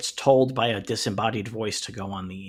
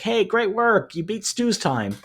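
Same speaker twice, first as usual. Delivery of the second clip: higher-pitched imitation of a cheering group; lightly acted, drawn-out praise in a raised pitch; mock congratulations; 8 semitones higher, slower than usual. Gang greets her after the elevator test, mock congratulations.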